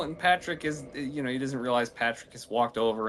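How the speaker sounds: ordinary talk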